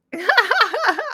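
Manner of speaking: loud, high burst of giggles; real high chuckle; real laughter. Real, not acted. Laughs after saying nope.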